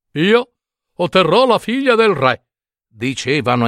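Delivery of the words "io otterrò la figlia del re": proud confident claim; boastful brothers. Acted. Brothers boasting to each other, proudly certain. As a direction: announce it like a sure winner.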